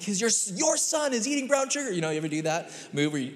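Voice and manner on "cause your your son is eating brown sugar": husband blaming his wife's son, mock exasperated; exasperated dad. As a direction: hand the problem over to her. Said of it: "I'm like".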